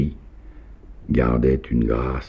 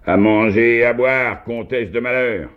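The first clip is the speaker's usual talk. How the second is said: much higher, louder howl; 12 semitones higher, 5 dB louder than usual. A narrator's reading. Drunk host howls at the maid for food, furious. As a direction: bellow it up high at the old woman. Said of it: "et hurla".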